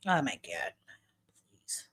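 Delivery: whispered under her breath; whispered exasperation. Real, not acted. Streamer reacting to a text, whispered.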